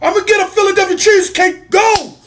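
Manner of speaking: high-pitched mock cheer; imitated fan cheering. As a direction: cheer like a hungry hometown fan. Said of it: "they cheer".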